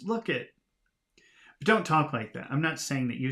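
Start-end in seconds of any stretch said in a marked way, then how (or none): 0.0-0.5 high-pitched amazed exclamation; demonstrated childlike astonishment; big surprise. Shown as a demonstration. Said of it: "in a moment of big surprise".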